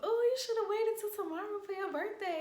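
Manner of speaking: high-pitched mock of a cousin; cousin's voice. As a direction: squeak it out as the teasing cousin. Voicing cousin on the phone.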